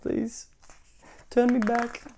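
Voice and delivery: high whimpering plea; mock-tearful squeaky pleading; crying pig begging. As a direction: beg the wizard like a tearful little pig. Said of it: "Grumble as a pig, crying, begging".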